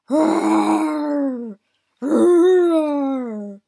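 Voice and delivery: loud, drawn-out monster moan; monster roar. A narrator's reading. Casey lumbering toward the dragons, moaning monster roar. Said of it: "in his deepest monster voice".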